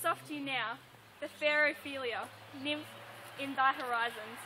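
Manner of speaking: soft, measured verse reading; subdued soliloquy recital. Acted. Student closing Hamlet's soliloquy, quiet recitation. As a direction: let the speech sink into quiet.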